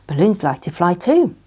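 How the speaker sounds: plain reading voice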